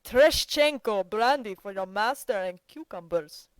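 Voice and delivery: loud, relishing order; enjoy the sound of your own voice; shouting with relish. A narrator's reading. Yashvin shouts an order to the servant, loud and relishing. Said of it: "he shouted, obviously taking pleasure in the sound".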